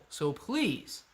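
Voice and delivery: ordinary talk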